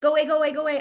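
loud, high, frantic go away; recoiling, pushing the voice away in fright; retold panicked go away. Thirteen-year-old shooing off a spirit voice, frantic and loud. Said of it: "And I actually went like this".

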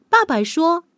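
usual speaking voice

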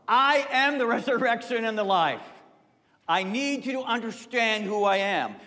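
high, loud preaching of I am; forceful declaration. Acted. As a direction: proclaim it to the whole crowd. Jesus declares himself to the crowd.

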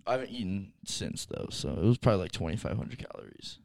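far from the mic, muffled. Real, not acted. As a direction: talk away from the microphone. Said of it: "Now I can hear you".

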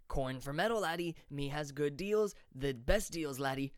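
a put-on voice for the toothless peddler, pushy; shabby merchant voice. A narrator's reading. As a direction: sell it like a toothless street hawker. Merchant character voice.

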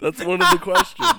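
high, loud words through laughter; helpless laughter. Real, not acted. Defending a silly question to a co-host, laughing hard. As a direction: squeal it out while cracking up. Still cracking up over question.

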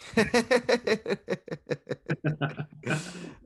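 high, drawn-out laugh; long laugh. Real, not acted. Co-host laughs at the slip.

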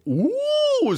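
very high held "ooh"; mocking imitation of a chorus teacher's siren; teacher's warm-up hoot. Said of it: "Mister Kelsey was up there going".

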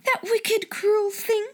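high indignant outburst; bursting out. A narrator's reading. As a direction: burst out in fury. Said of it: "she burst forth".

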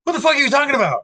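high-pitched mock indignation; incredulous retold anger, pitched high; imitated mad outburst. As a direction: mimic an outraged bride, voice shooting up.

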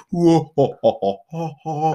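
spooky laugh. Pretend. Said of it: "give it a spooky feel".

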